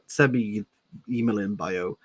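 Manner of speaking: usual speaking voice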